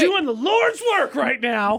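high, slow mock-pious boast; a hint of a preacher. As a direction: play the self-righteous saint, slowly. Mock righteous self-praise.

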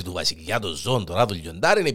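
ordinary delivery

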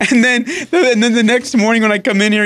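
ordinary delivery

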